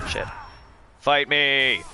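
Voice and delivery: ordinary talk, nothing marked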